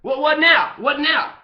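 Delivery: mock confrontation yell at a cop; challenging shout. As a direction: shout it like you just jumped out of the car. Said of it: "I'll be like".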